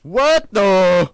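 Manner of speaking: loud startled what the; sudden loud cry of shock; shocked outburst. Real, not acted. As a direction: blurt it out in alarm.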